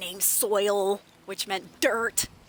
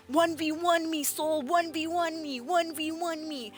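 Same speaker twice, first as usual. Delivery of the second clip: higher, nagging chant, slowing down; mimicking players; 7 semitones higher than usual. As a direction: whine it like a pushy gamer.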